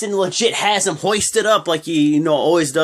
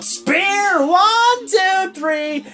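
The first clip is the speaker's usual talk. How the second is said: hyped wrestling commentator; excited commentator call; 11 semitones higher, slower than usual. Retold or imitated. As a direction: shout the finish like a play-by-play caller. Calling the finish like commentator.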